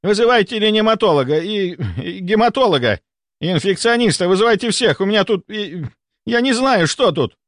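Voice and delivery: high, faltering cries for help; total panic. A narrator's reading. Volkonsky phones for every specialist, in total panic. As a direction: plead frantically, pitch up, tripping over the list. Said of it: "Полная паника".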